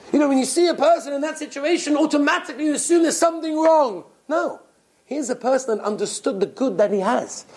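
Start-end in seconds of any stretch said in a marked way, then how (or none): none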